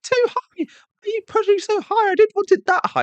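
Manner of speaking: put-on very high, loud whiny child complaining; yelling kid. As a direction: shriek like a scared kid on a swing. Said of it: "they start yelling".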